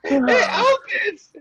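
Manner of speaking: high, slow, laughing; stifling laughter. Real, not acted.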